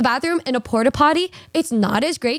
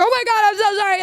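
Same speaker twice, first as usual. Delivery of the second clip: higher, panicky sorry; flustered apology; 7 semitones higher, faster than usual. Retold or imitated. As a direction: blurt it out, mortified. Flustered apology after walking in.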